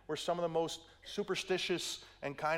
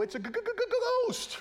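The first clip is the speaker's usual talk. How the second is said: stammering terrified squeak, voice jumping much higher on ghost; Shaggy-style fright; 4 dB louder than usual. Retold or imitated. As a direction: stutter the g in panic, then break up high and louder. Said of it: "they turn into Shaggy from Scooby-Doo".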